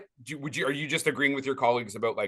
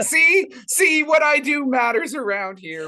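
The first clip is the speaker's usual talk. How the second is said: high, loud, triumphant squeal; shouting with delight, pitched way up; gleeful vindication; 8 semitones higher, 6 dB louder than usual. Retold or imitated. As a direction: crow about it like a proud producer. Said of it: "message from our producer".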